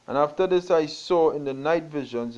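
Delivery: solemn King James reading; scripture reading. Acted. Preacher reading Daniel to the congregation, solemnly. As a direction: read the prophecy with weight.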